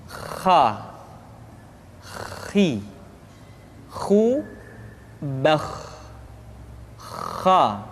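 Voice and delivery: "Kha", "Khi", "Khu", "Bakh" with a raspy back-of-throat kh; snore-like kha. Shown as a demonstration. Teacher modelling the letter kha, "Kha" rasped like snoring. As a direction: let breath flow through "Kha" like a snore. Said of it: "persis terdengar seperti orang yang tidur dalam keadaan mengorok".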